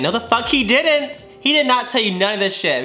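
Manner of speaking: mock outrage, flatly refusing to believe it; retold indignation. Thinking back at ex's God talk, indignant denial. Retells indignant inner reply to ex.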